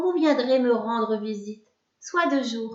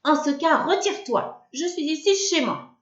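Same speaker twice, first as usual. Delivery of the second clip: haughty witch; 6 semitones higher than usual. A narrator's reading. Witch voiced ordering him out.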